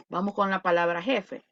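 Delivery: ordinary talk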